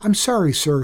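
put-on boy apologizing to the angry engineer; stammered apology. A narrator's reading. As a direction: stumble out a frightened apology. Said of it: "he stammered".

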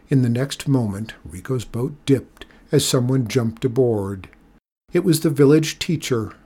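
ordinary delivery, nothing marked

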